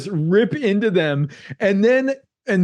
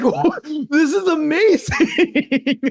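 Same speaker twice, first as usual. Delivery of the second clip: laughing awe; 9 semitones higher, much slower than usual. Real, not acted. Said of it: "laughing at the scene".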